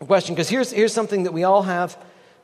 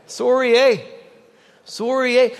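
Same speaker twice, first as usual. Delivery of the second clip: higher, louder Canadian "Sorry, eh?"; 5 semitones higher, 4 dB louder than usual. Shown as a demonstration. Pastor playing up a Canadian accent, lightly hammed. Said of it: "we can really get Canadian".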